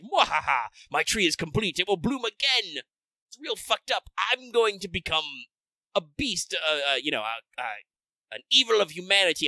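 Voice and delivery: high, cackling gloating villain; sneering panto villain. Retold or imitated. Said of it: "he's like".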